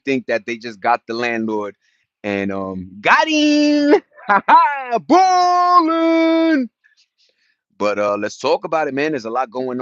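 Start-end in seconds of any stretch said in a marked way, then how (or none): 3.0-6.7 gloating laugh. Acted. Gloating about the landlord deal.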